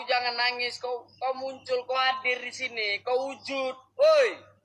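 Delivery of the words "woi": shout. Real, not acted. Calling out to the spirit.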